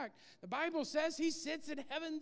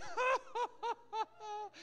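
hearty laugh; 8 semitones higher than usual. Acted. God's laugh acted out.

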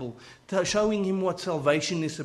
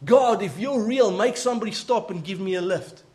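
higher, louder cry to heaven, easing off; retold shout to God; 4 semitones higher, 5 dB louder than usual. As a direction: call out an angry challenge, as if retelling it. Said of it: "shouting to God".

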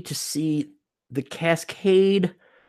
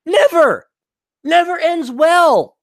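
emphatic never; stress on never; 13 semitones higher, 5 dB louder, slower than usual. Real, not acted. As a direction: hit never high and loud. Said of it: "You never tell the truth".

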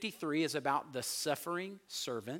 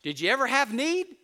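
questioning disciples; 8 semitones higher, 7 dB louder than usual. Retold or imitated. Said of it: "they say it here".

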